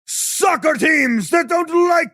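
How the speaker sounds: high shouted battle cry; roaring rallying speech. Acted. Commander rallying troops.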